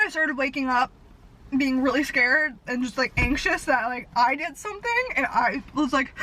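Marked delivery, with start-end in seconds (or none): none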